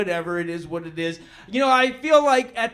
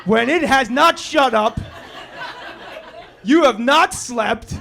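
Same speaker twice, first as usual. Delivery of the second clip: normal talking voice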